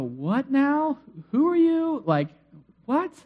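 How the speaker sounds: high, baffled questions; stunned disbelief. Retold or imitated. Brothers reacting to Joseph's reveal, high and stunned. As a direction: voice jumps up in confusion. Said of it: "for them to go".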